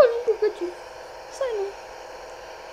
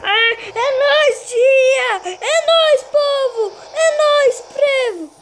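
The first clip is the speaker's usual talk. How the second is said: excited cheering; 6 semitones higher, 5 dB louder, slower than usual. Real, not acted. Celebrating, laughing and yelling.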